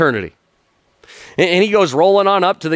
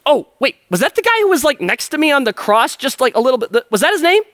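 very high, excited realization; suddenly remembering the man on the cross; dawning thief; 11 semitones higher than usual. Retold or imitated. Thief realizing Jesus was beside him, very high and eager. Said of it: "the guy's like".

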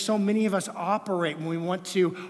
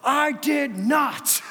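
put-on high, slow, loud indignant kid's denial; a guilty kid denying it hard; defensive child; 10 semitones higher, 8 dB louder, much slower than usual. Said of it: "but she's so defensive".

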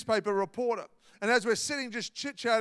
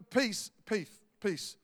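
fading repetition; 7 dB quieter, slower than usual. Acted.

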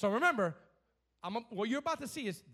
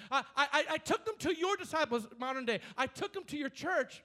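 raised, insistent father's complaint building to a shout; pleading father; 5 semitones higher, 5 dB louder than usual. Retold or imitated. Father telling Jesus his son wasn't healed, insistent. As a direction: press the case like a desperate parent. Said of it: "So watch this".

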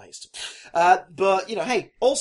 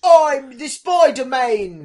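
mock hero voice; 7 semitones higher, 11 dB louder, much slower than usual. Mock Tom Holland line.

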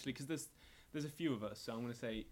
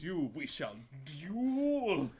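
slow, loud, higher knight's challenge; dueling challenge; 4 semitones higher, 7 dB louder, much slower than usual. Acted. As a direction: declare the duel with pompous slowness. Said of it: "and then he goes".

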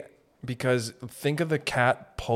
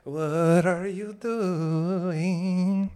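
high, hugely drawn-out wail; 6 semitones higher, much slower than usual. Acted. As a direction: drag every vowel out, very slowly. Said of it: "Some, like, Egyptian".

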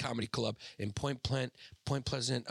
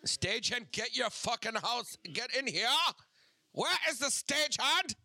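put-on German accent; 11 semitones higher than usual. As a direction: bark it like a German villain. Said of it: "do a German accent when they scream".

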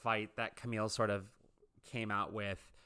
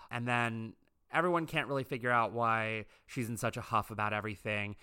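normal talking voice

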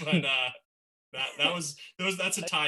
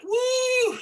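high, loud mock crowd whoop; imitating a cheering audience; 7 dB louder than usual. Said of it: "everyone's like".

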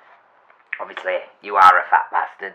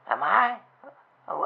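normal talking voice

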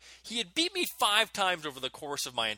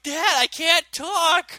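high, loud, slow choked plea; strangled pleading; 13 semitones higher, 9 dB louder, much slower than usual. Retold or imitated. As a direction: squeeze it out while being choked.

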